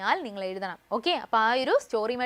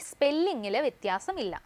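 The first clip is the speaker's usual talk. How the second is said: ordinary talk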